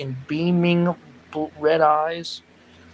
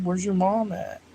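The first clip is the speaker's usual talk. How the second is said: usual speaking voice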